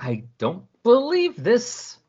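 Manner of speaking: slow, high disbelief; Mary Jane fed up at the stowaway, slow and high; exasperated. Retold or imitated. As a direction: stretch out the outrage. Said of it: "Mary Jane's like".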